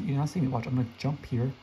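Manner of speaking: normal talking voice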